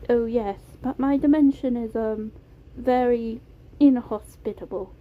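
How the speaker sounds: put-on careful British accent, hesitating midway; Beam, British accent. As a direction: explain delicately in a British accent. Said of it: "It was a soft British accent".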